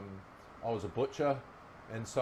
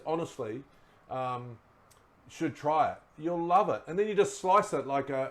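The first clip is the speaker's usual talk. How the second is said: normal talking voice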